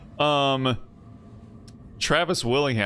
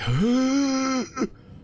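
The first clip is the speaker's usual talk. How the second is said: long, higher-pitched gut exhale; imitated air-expelling noise. Streamer mimics how a character speaks, forced-out breath. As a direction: push all the air out in one long noise. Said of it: "trying to expel all the air out of his gut".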